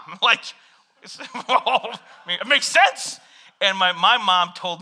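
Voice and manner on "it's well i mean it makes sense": laughing. Real, not acted. Laughing at own story.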